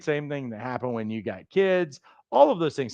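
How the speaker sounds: ordinary delivery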